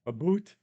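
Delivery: "A boot" for about, with an oo vowel; Canadian "about". Shown as a demonstration. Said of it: "are some of the Canadian words".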